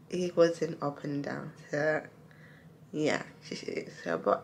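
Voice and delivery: talking while showing teeth; speaking with finger pulling lip. Real, not acted. Seen 1: face clear; finger pulls lower lip down, baring teeth throughout.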